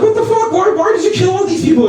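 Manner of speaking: very high-pitched, alarmed questioning; appalled disbelief. Retold or imitated. Teacher confronts little girl over mass grave drawing, appalled. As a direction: squeak out mock horror at the pupil.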